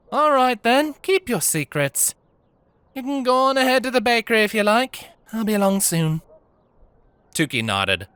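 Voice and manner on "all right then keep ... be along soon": high, brisk, good-natured voice; motherly baker. Acted. Diana sends Tukey ahead to the bakery. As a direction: send her off with easy warmth.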